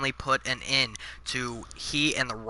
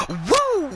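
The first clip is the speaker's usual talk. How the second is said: high ringing woo as a signature cry; a loud, high Nature Boy woo; Flair woo; 5 dB louder than usual. Acted. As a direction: throw in a sharp falsetto woo. Voicing Flair in a promo.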